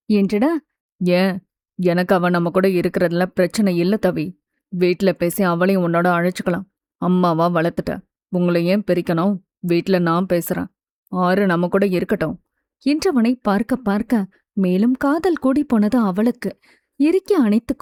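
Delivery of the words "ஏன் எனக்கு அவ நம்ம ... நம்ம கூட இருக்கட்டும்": low, even male voice; calm, reassuring man. A narrator's reading. Mithul assuring Thavi the child can stay, calm. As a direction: lower the voice and reason steadily.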